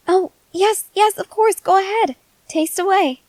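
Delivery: eager, hopeful invitation. Acted. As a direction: urge them to taste, all keyed up. Eager for her to taste.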